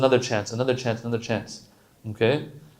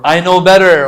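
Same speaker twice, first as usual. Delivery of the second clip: arrogant; 6 semitones higher, 14 dB louder than usual. Retold or imitated. Arrogant sinner voiced in sermon.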